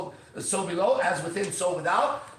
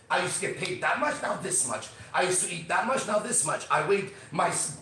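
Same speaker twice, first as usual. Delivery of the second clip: ordinary talk, nothing marked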